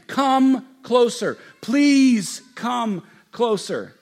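slow, drawn-out plea; drawn-out loving call; tender invitation. Acted. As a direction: invite them in, stretching it. God inviting humanity.

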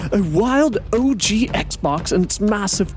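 hushed wildlife narrator, building to drama; nature documentary. Pretend. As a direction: narrate a GameCube hunt like nature TV. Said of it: "a documentary on the GameCube".

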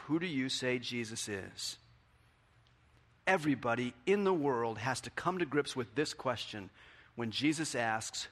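ordinary talk

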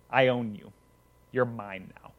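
a quiet threat to a man on the ground; a hint of a sinister villain; menacing bully. Tommy menaces Bobby.

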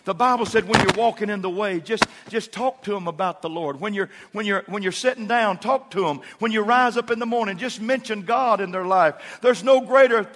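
loud, high, building sermon cry; full force preaching. Acted. Pastor urging the church to talk about God, loud. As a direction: drive it home to the congregation.

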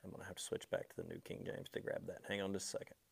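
hurried muttered aside off mic; fast, soft, half to himself; quiet aside. Real, not acted.

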